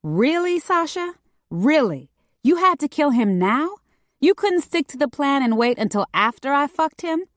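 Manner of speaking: high-pitched, exasperated complaint; indignant, high-voiced questioning; pissed off. A narrator's reading. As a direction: scold your partner in disbelief, pitched high. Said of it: "even more pissed about the blood".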